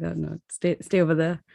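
usual speaking voice

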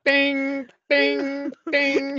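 loud, high bing bing bing; mock gunshots. Shooting Puritans walking by, loud mock gunshots. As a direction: fire off three toy-gun bings.

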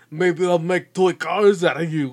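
hammed-up bad celebrity impression; Arnold. Villain threatening to make toy cars, hammed-up Arnold impression. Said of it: "I do an Arnold impression".

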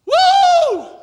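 very high, loud, drawn-out "woo"; joyful whoop. Real, not acted. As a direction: let out an exultant holler. Joyful whoop while preaching.

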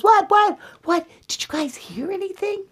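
high, fast, jolted awake; startled Goldilocks. Acted. Goldilocks jumps up from sleep, high startled questions. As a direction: blurt it out as a girl startled from sleep. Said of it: "Goldilocks jumps up and she goes".

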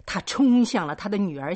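ordinary delivery, nothing marked

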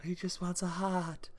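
high, soft, breathy mock plea; breathy Tin Man voice. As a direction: imitate an emotional Tin Man, all breath. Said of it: "He even talks in this breathy voice".

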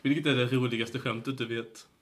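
normal talking voice

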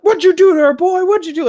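high, loud mock interrogation; cops' accusing shout. Cops confronting the boy beside a distressed woman, accusing shout. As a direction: imitate cops yelling an accusation. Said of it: "the cops roll up there".

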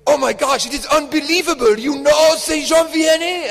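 put-on loud, high, over-the-top French accent; hammed-up French accent, ecstatic disbelief; outrageous French accent. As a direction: imitate an astonished Frenchman in a thick French accent. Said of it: "like, an outrageous French accent".

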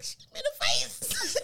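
high, tearful whimper; imitating a crying child telling on her cousin; mock crying. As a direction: whine it like a hurt kid. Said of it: "I was like".

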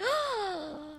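sharp amazed gasp. Retold or imitated. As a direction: gasp in awe. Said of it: "and they're like".

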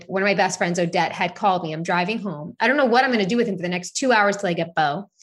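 ordinary talk

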